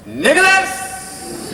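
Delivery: high, drawn-out call of a son's name; hammed-up cross mom summoning her boy; angry mother. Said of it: "my mother was very angry with me".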